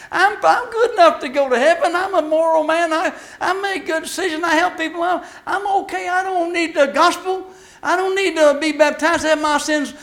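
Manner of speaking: high, loud mocking voice of a proud sinner; preacher mocking the smug moral man, high and loud; smug self-righteous man. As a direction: lampoon the man who thinks he's good enough.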